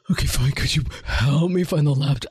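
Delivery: low, put-on sullen kid asking for help; grudging compliance. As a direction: imitate a teen reluctantly being civil. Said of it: "it brings it down to".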